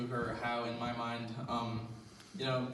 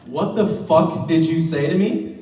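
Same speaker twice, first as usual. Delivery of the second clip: loud, slow, threatening yell; explosive playground rage; furious shout; 5 semitones higher, 16 dB louder than usual. Retold or imitated. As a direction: roar it like a kid about to swing. Angry six-year-old picking a fight.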